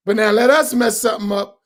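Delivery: ordinary talk, nothing marked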